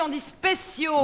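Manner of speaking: "spéciaux" begun straight on sp; high, slow, careful "spéciaux" with no leading e; correcting pronunciation. Shown as a demonstration. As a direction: spell out "spéciaux" as spé-ciaux, insistently. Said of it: "Dans ce cas précis".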